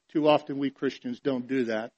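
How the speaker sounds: ordinary delivery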